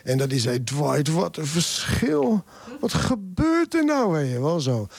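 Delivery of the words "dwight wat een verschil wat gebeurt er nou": slow, high-pitched mock astonishment; imitated bewilderment. Overwhelmed trainer phones his colleague from the bus, bewildered. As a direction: stretch it out, climbing high, as an overwhelmed caller. Overdonderde trainer belt hem verbijsterd op.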